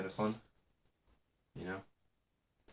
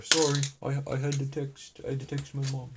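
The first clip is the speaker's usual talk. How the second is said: mock British accent; 7 semitones higher than usual. Imitating own past stream self.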